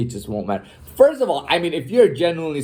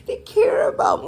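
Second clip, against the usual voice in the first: very high, breathy, whimpering mockery; mocking whimper. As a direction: squeak it like a crybaby to ridicule him. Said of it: "I hate when people talk like that".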